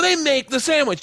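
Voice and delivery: high-pitched mock insistence; hammed-up assertion. Actor in the play insisting on sandwich, high and hammed-up. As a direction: declare it high, like bad stage dialogue. Performing play lines, first character.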